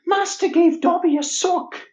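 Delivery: Dobby. Acted. Co-host asked for the freed house-elf, Dobby voice. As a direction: squeak it out high like a little elf. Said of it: "can you do Dobby?".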